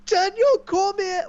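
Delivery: high, far-off screaming of pure joy; ecstatic screaming. Retold or imitated. Fan reliving the title win for his friend, wild screaming. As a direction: shriek it out like your champion just won.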